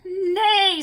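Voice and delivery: excited neigh. A narrator's reading. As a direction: neigh with glee. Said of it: "said the little chicks with excitement".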